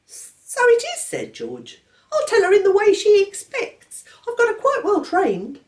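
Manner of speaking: put-on a severe baby laying down the law; stern baby George.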